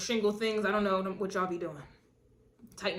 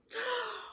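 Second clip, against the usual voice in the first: mock gasp; 12 semitones higher, 7 dB quieter than usual. Mock jealous ex gasping.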